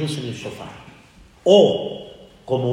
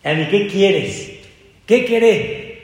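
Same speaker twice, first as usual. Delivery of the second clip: louder, lightly voiced old rabbi asking what you want; a hint of the rabbi's broken Spanish, said up louder; imitating Jajam Shimon; 5 semitones higher, 5 dB louder than usual. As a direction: rabbi Shimon gently pressing a petitioner. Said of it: "en su español muy pobre".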